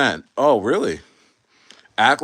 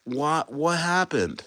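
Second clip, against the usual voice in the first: hushed mock panic, slowing at the end; mock confusion; 3 semitones higher, 7 dB quieter than usual. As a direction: play a stunned trader, quieter. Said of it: "they're like".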